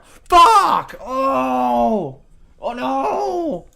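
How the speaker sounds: sudden high, loud cries of pain; voiced agony, shrieking; pained screams. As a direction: scream as the nail is torn. Character tortured, nail clipped.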